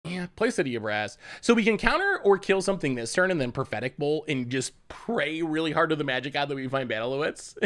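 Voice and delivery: usual speaking voice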